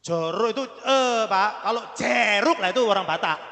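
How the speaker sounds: ordinary talk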